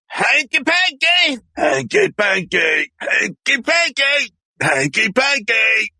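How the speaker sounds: very high, slow, shouted hanky-panky; high shouted catchphrase. Acted. Performer shouting his catchphrase at the studio. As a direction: belt it out high and slow, over and over.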